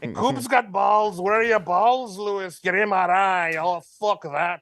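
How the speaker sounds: put-on goading in a comic Irish accent; Conor McGregor. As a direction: needle your co-hosts in stage Irish. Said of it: "Do we have Connor on the line".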